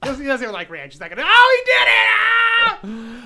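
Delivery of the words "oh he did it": shouting in delighted disbelief; excited shout. Real, not acted. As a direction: yell it out, thrilled. Friend finally tastes the vinegar.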